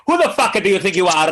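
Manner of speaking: mock an offended Italian, thick comic accent. Comic imagines an Italian calling him out, Italian accent. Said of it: "gonna do that accent".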